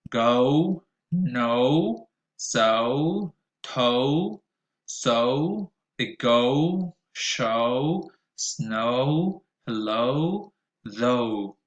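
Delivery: put-on "Oat", "old", "ocean" said slowly with a clear rounded O diphthong; model words, repeat after me. Shown as a demonstration. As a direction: read each word slowly for students to repeat. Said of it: "O at the end of words".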